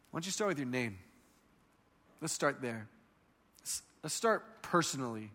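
soft, low and intimate; gentle invitation. Acted. Jesus to a tormented person, gentle and soft.

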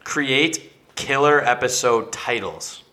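slow reading. Real, not acted. Reading list item aloud.

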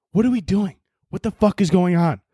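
ordinary talk

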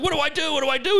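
high-pitched, flustered plea; retold panic.

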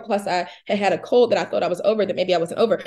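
ordinary talk

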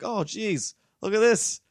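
high, loud voiced cat amazed at food. The cat finding food already in its bowl, high and loud. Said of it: "And then he was like".

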